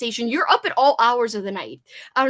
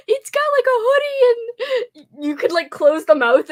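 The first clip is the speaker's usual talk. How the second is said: giggling description; 12 semitones higher, 5 dB louder than usual. Real, not acted. Laughing describing shark hoodie.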